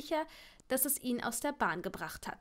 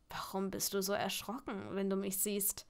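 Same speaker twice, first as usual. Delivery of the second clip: teasing man; 3 semitones lower, 5 dB quieter than usual. A narrator's reading. Teasing man surprises her.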